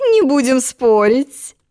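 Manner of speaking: slow, placating queen; calm them down gently; soothing. Acted. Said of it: "примирительно сказала".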